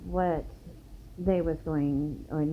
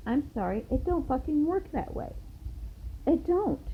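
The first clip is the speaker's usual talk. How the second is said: high-pitched indignant retort; retold telling-off, pitch leaping up in protest; going off on them; 9 semitones higher than usual. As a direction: tell them off, voice climbing. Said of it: "I went off on them".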